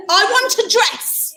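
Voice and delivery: high, emphatic demand; tantrum demand. Acted. Cinderella lists wants to the fairy, tantrum demand. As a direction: demand it like a stamping child. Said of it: "a bit of a stampy tantrum".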